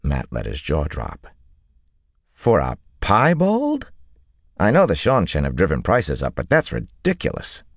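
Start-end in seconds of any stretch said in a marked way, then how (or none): 2.3-7.6 incredulous haggler, pitch leaping up. A narrator's reading. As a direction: balk at an outrageous price. Buyer's jaw drops at the price.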